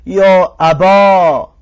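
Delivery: «یا ابا» slow, ending on a long open "aa"; alif ending demo. Shown as a demonstration. Teacher modelling the alif form for students, slow. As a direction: hold the final "aa" of «یا ابا».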